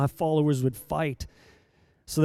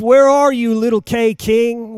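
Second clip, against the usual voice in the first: high, loud cry of where are you; loud call; 5 semitones higher, 9 dB louder, slower than usual. Acted. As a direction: cry it out like a pastor seeking the lost. Preacher calls out loudly.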